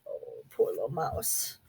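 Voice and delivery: soft, breathy whisper; whispered pity. A narrator's reading. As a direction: whisper it with sympathy. Narrator pities mouse.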